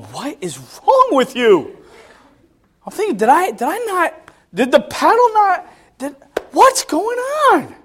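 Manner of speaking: high-pitched, mock-frantic bewilderment; upset exasperation. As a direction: imitate a parent losing it, climbing to a squeal. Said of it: "I'm all upset".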